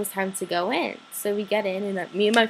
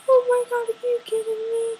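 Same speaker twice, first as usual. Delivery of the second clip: very high, ecstatic squeal; high-pitched fangirl squeal; freaking out; 4 dB louder than usual. Retold or imitated. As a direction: shriek it in giddy disbelief. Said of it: "I literally freaked out".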